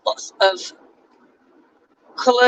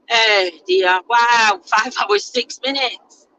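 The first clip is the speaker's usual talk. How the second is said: ordinary delivery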